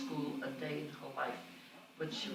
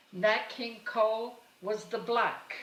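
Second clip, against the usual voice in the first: normal talking voice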